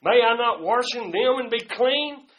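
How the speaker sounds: loud, indignant outburst; angry protest. Acted. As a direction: Naaman fuming at the prophet. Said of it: "he gets mad and says".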